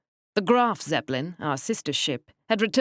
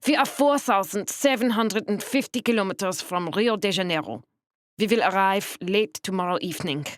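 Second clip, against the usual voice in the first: put-on precise, stiff thick German accent; 4 semitones higher than usual. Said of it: "Her accent was the thickest".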